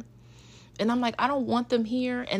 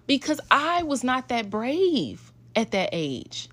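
usual speaking voice

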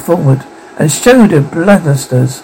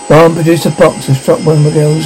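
ordinary delivery

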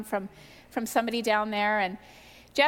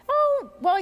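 eager; 13 semitones higher, 6 dB louder than usual. Retold or imitated. Retells her eager ask to God.

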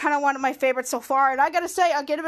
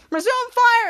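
raised, louder mock battle order; called-out command; 7 semitones higher, 5 dB louder, slower than usual. Character restarting the laser battle, calling out. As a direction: call the order across the game.

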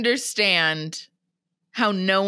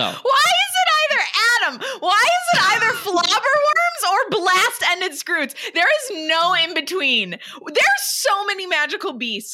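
exasperated shout; 11 semitones higher than usual. Real, not acted. Cohost fed up with Hagrid's beast choices, exasperated laughing shout.

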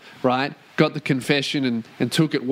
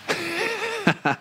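high laugh; 3 dB quieter than usual. Real, not acted. Laughs at own joke.

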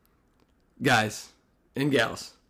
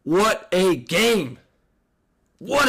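excited; 6 semitones higher, 3 dB louder, much slower than usual. Real, not acted. Hyped opener exclamation.

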